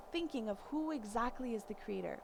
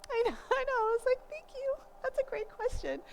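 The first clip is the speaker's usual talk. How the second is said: high, laughing delight at a clever question; 14 semitones higher than usual. Retold or imitated. Mother praising her son, laughing high and delighted. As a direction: laugh and gush, much higher.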